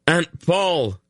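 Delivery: high urgent shout. A narrator's reading. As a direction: call her through the battle. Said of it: "he shouted".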